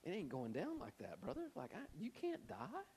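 hushed man-to-man objection; confidential aside. Retold or imitated. Peter privately correcting Jesus, quiet aside. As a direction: keep it down, as if pulled aside. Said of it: "he pulls him to the side".

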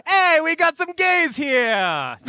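high, slow barker call; hammy New York mobster accent; loud carnival barker. Pretend. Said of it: "anytime I try to do an accent".

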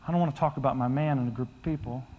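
low, soft retold confession; subdued reluctance. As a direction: quietly voice her reluctance. Retelling the widow's reluctant confession.

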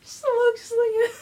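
very high, slow, wheezing laugh; crying with laughter. Real, not acted. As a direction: squeal the title while crying laughing. Howling at the show name.

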